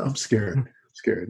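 small, soft voice; softly acted confession; mock scared admission. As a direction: quietly own up to fear, playfully. Acting out men admitting fear.

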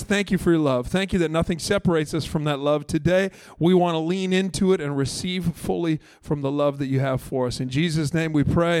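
ordinary talk, nothing marked

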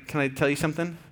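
ordinary talk, nothing marked

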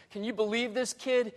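high-pitched, acted bragging to the guests; proud father's boast. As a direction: show off your son, voice lifted high. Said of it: "he says it like this son is a self-made".

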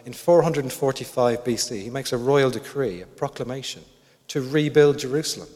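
ordinary delivery

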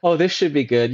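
wry dread. Retold or imitated. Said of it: "and I go".

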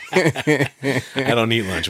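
high-pitched laugh running through the words. Real, not acted. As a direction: laugh it off while denying. Laughing at friend's lunch joke.

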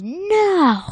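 high, loud, drawn-out cry of refusal; shouted refusal. Acted. SpongeBob refusing to take back what he said, shouted. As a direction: yell it long, pitch soaring.

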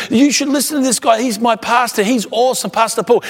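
high, emphatic endorsement; imitating loud talker. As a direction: mimic an eager fan talking up a friend. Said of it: "talk at one volume loud".

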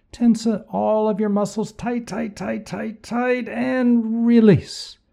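high, straining tight tight tight; strained high squeeze. Acted. Coaching muscle squeeze.

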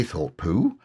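usual speaking voice

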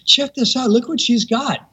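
high, eager retold voice; excited showing-off. Woman pointing out gliders to attendant, excited. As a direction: imitate someone eagerly showing off a find.

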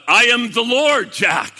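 loud, grand divine announcement; booming voice. Retold or imitated. The Lord revealing himself to Jack, booming. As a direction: boom it out like God. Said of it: "the voice booms out".

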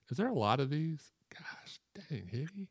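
exasperated whisper under breath; whispered aside. Real, not acted. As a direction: whisper it, tired of the list. Aside about the long list.

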